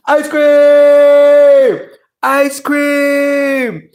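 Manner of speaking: high, held-out mock scream; excited calling out. Acting a kid who hears the ice cream man, high yell. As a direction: cry it out high, stretching the vowel.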